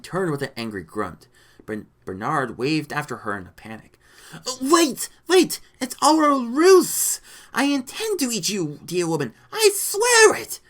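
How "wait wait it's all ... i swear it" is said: loud, high pleading shout; panicked cry. A narrator's reading. Lizard man begging Rita to believe his ruse, crying out. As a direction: cry out desperately to stop her leaving. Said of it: "he cried".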